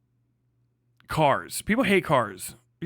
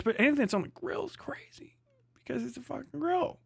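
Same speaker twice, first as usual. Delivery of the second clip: whispered high and soft, disbelieving; incredulous whisper; 10 semitones higher, 6 dB quieter than usual. Real, not acted. Ranting to chat about AI-checked grilled meat, incredulous whisper. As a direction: hush it like it's too absurd to say aloud.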